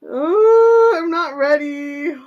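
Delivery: loud high "oh" easing off; high, whiny not-ready wail; nervous wail. Real, not acted. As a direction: moan it in excited dread. Dreads pressing play.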